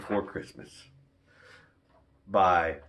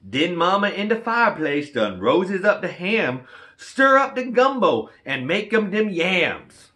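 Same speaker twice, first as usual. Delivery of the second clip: put-on higher, louder Cajun storyteller voice for the kids; Cajun story reading; 9 semitones higher, 7 dB louder than usual. Reading a Cajun Christmas book to kids, higher, louder Cajun storyteller voice. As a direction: spin the Cajun tale with lively bayou flair.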